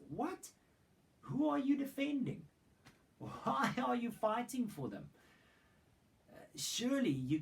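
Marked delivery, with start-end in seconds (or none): 0.1-5.1 much higher and louder, aghast disbelief; incredulous outcry. Acted. Preacher to the tribe of Benjamin, aghast high disbelieving cry. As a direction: throw up your hands in disbelief, pitch soaring.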